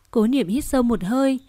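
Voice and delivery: plain reading voice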